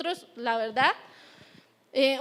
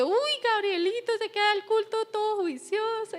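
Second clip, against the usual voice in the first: a light mock-admiring voice, pitched higher; admiring churchgoer; 6 semitones higher than usual. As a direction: coo like an impressed church member. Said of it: "hay personas que sí sienten como que". (tono de admiración fingida)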